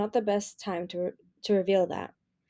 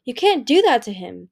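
protesting; 3 semitones higher, 7 dB louder than usual. A narrator's reading. Turtle protesting.

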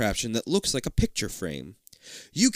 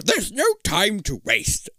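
high, slow-stretched put-on scientist voice; interrupting scientist; 6 semitones higher, slower than usual. A narrator's reading. Doctor Peebles interrupting the kid, urgent. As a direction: cut in grandly in character. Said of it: "Doctor Peebles interrupts".

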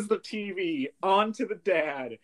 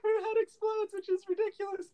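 much higher, incredulous squeak; mock disbelief; 3 dB quieter than usual. Real, not acted. Incredulous high repeat.

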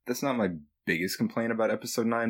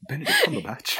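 Rey's voice; 3 dB louder, faster than usual. Retold or imitated. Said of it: "she's like".

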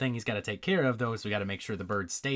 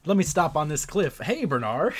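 higher, louder casual holler; calling out; 7 semitones higher, 7 dB louder than usual. Acted. Wilbur dropping in on his friend, calling out. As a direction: call out to a friend, raised voice.

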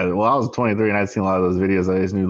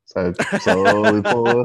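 slow, laughing through the words, much higher; laughing slowly; 11 semitones higher, 4 dB louder, much slower than usual. Real, not acted. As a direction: drag it out while cracking up. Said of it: "I'm gonna do it slow".

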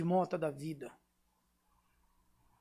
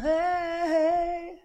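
shouting; 11 semitones higher, 4 dB louder than usual. Retold or imitated. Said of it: "Só, só gritando".

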